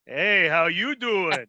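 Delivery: slow, smooth pickup line; Joey Tribbiani line. Retold or imitated. Friend imitating Joey Tribbiani, slow smooth charm. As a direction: lay on the charm, slow and easy. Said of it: "Joey Tribbiani would be".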